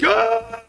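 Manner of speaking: high acted war scream; mock battle scream. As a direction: answer with a mock warrior's yell. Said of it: "And I'd, like, scream".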